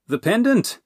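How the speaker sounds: high, loud burst of realization; sudden realization. Acted. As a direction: blurt it out as it clicks. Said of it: "Dipple guessed".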